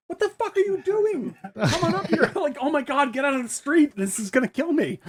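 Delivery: high, laughing disbelief; Dorothy yelling down from the window, high and laughing; exasperated. Retold or imitated. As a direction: shriek it through laughter at the fool in the street. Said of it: "she's like".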